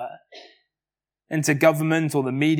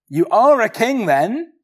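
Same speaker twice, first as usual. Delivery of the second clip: higher, louder mock Roman governor; Pilate's scornful retort; 6 semitones higher, 4 dB louder than usual. Pilate to the prisoner Jesus, scoffing and raised. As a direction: toss it out like a sneering judge. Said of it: "Pilate responds back in our drama".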